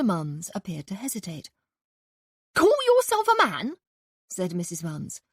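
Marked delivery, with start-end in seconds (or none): 2.6-3.8 high, loud indignant housewife; scolding landlady. A narrator's reading. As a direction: berate him like an outraged landlady. Landlady taunts husband.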